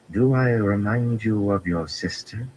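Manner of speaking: a veiled threat, posed sweetly; dangerous, pointed question. A narrator's reading. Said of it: "she asked in a dangerous tone".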